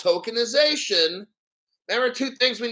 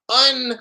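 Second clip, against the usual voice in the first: unfucking punched loud and high; emphatic; 4 semitones higher, 6 dB louder, faster than usual. Real, not acted. Streamer to chat, punching unfucking. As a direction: hit the un-fucking hard.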